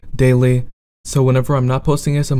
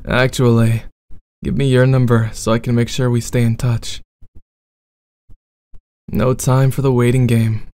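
bad boy roleplay.

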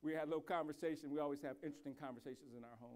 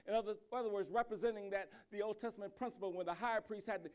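louder, driving sermon voice; rising preaching; 4 semitones higher, 5 dB louder than usual. Acted. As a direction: build the fervor as you tell it. Builds into preaching.

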